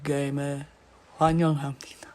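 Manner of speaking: low, hushed game-host voice; deep-pitched announcement, trailing into a whisper. Shown as a demonstration. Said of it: "you want me to do the lower?".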